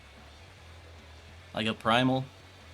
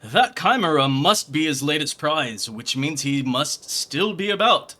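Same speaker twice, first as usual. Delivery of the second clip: louder, grandiose gentleman detective voice; pompous inspector; 3 semitones higher, 7 dB louder than usual. Acted. Hildebrand greets Gilgamesh and plans the search, pompous and loud. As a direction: puff up like a self-important sleuth.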